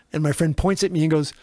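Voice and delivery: ordinary talk, nothing marked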